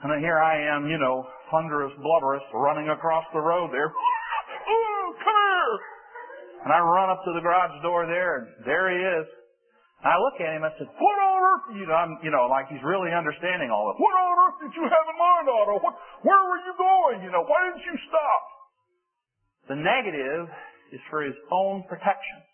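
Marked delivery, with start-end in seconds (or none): 3.9-5.8 high, breathless calling out; chasing the dog across the road, calling; frantic calling. Retold or imitated. 11.0-11.7 high, louder scolding cry; exasperated scolding. Retold or imitated. Scolding dog at garage. 14.0-18.4 high, louder scolding of the dog; exasperated scolding. Retold or imitated.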